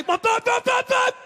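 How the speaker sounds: loud, high shouted bumps; bumping car noise. Retold or imitated. Car speeds off down the driveway, shouted bumps. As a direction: shout each bump as the car bounces.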